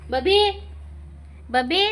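high coaxing name call; calling a pet. Real, not acted. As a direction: sing out the pet's name up high.

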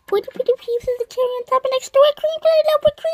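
put-on high, fast, loud kid voice; excited kid. Excited child ordering a sundae, high and fast. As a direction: rattle off the order like an eager child.

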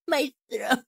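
high, soft mock whimper; pained whimper. Voice actor jokes about screaming hurting, pained whimper. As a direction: act out a sore throat, small and high. Mimes pain after screaming.

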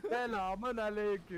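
slow, high, called-out hello; drawn-out greeting. Real, not acted. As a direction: stretch the greeting out playfully. Calls a greeting to players.

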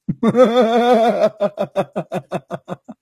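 long helpless laughter; can't stop laughing; laughing too hard to go on. Real, not acted. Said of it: "Sorry, I cannot".

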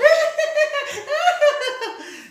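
very high, loud shrieking laugh; recalling sister's laugh, very high shrieking imitation; imitated high laugh. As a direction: mimic her shrieking laugh. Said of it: "I remember your laugh".

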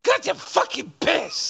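high mocking echo of her complaint; mocking imitation. Host repeating the streamer's line, high mock echo. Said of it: "Listen to the way she says this".

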